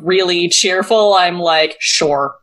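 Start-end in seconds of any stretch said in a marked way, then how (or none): 1.8-2.3 grumpy curt reply. Retold or imitated. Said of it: "this is how I write ... when I'm really grumpy".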